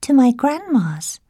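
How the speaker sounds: put-on high, eager girl's reply; little girl voice. A narrator's reading. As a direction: answer brightly like a child. Girl answers.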